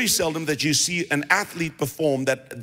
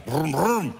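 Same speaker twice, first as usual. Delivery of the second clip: car engine noise. Retold or imitated. Driving his car.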